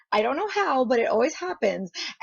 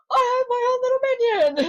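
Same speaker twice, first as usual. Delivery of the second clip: high, loud mock-delighted cry; gleeful show-off; 5 dB louder, much faster than usual. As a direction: crow over a new toy. Retold delight at the plushie.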